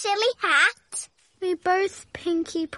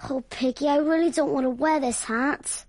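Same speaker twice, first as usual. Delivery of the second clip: glum; 8 semitones lower than usual. Acted. Reluctant, dreading wearing hat.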